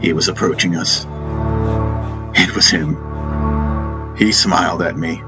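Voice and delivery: plain reading voice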